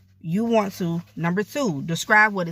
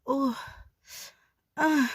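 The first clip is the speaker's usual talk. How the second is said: soft moan sliding up high; quick sample moan, climbing in pitch; demo moan; 7 semitones higher, 5 dB quieter than usual. Shown as a demonstration. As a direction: let out a soft moan that rises. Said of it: "you know, moan".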